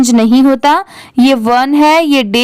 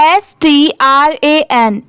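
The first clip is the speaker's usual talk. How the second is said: letters spelled out slowly one by one; teacher spelling slowly; much slower than usual. Shown as a demonstration. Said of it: "इसको आप ऐसे भी पढ़ सकते हैं".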